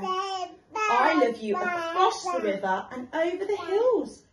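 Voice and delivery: big hare topping it gloriously; grand big hare. Acted. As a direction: paint the distance with the voice. Big hare's grand reply.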